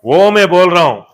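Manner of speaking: loud, high-pitched rousing proclamation; full-force preaching. Real, not acted. Preacher promising the congregation dead things revive, full-force preaching. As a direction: build to a shouted hallelujah.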